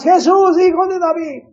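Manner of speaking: high, full-voiced shout; grito del ciego. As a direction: call out desperately to someone passing by. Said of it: "empieza a gritar una vez más". (grito agudo y fuerte de súplica)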